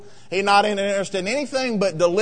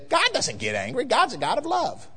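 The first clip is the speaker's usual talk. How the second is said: mock-sweet protest, lilting up high at the end; singsong, swooping parody of television preachers; 5 semitones higher than usual. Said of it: "all these people on T V say".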